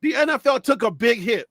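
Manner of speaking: ordinary talk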